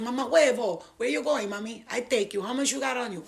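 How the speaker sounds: high, rapid-fire Dominican Spanglish; Dominican cabbie impression. Cab driver hailing her, excitable Dominican accent. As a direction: chatter in a hammed-up Dominican accent. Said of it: "you know the Dominicans".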